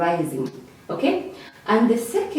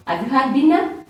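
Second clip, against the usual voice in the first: higher-pitched question climbing to "dinner"; rising question; 4 semitones higher, faster than usual. Shown as a demonstration. Teacher gives an example yes-or-no question, rising intonation. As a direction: ask it with the pitch lifting on "dinner". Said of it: "So those fall under rising".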